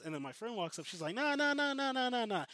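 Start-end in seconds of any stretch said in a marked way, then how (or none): 1.1-2.4 high, loud run of nahs; retold frantic protest, pitched way up; urgent interjection. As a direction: imitate a friend rushing in to object. Friend hurriedly steps in to vouch.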